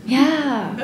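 ordinary delivery

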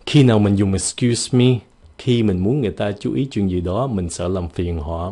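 ordinary talk, nothing marked

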